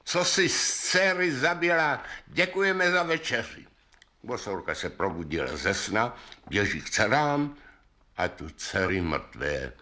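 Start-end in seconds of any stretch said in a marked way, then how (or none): 0.0-3.6 high taunting call up at a window. A narrator's reading. Riders call to the witch under her window, calling out. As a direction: call out to the old woman, pitched high. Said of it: "zavolá".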